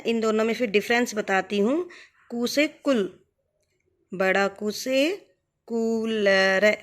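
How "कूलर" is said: कूलर with a very slow, long कू; drawn-out long oo. Shown as a demonstration. Teacher stretching the long vowel for students, slow drawn-out कूलर. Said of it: "अब इन दोनों को आप एक बार बोल के देखिए".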